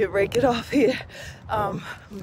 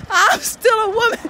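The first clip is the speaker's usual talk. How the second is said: laughing, pitched higher; 10 semitones higher than usual. Real, not acted. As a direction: say it still laughing. Repeats through laughter, higher.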